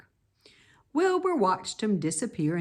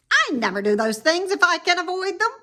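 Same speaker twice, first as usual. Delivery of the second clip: high, quick, sour snap; sour rat; 11 semitones higher, 8 dB louder, faster than usual. Acted. Templeton refusing Wilbur, sour and quick. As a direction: sneer it out fast. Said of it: "replied the rat sourly".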